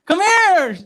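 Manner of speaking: high, loud retold holler; calling out. Older gentleman calling his wife to the door, retold holler.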